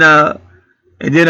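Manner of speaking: ordinary delivery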